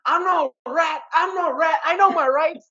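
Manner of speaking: high, pleading small-time crook; whiny street hustler voice. Retold or imitated. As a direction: beg off the cops like a petty crook. Imitating TJ the petty criminal.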